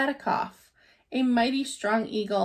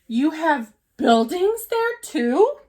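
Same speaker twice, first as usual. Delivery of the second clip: amazed question; 5 semitones higher, 6 dB louder, slower than usual. A narrator's reading. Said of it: "Horton gasped".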